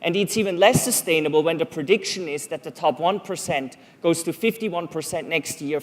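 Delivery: ordinary delivery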